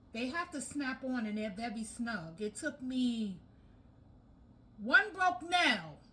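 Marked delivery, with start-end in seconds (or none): 4.8-6.0 exasperated. Real, not acted. Broke nail while assembling.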